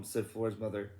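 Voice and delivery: ordinary delivery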